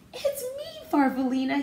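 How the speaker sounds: high, joyful shout. A narrator's reading. Goose shouting to his lost friend, high and glad. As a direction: call out in happy recognition. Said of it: "he shouted".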